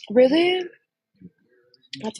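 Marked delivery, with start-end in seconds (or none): 0.1-0.6 surprised. Real, not acted. Hearing Connie's good news.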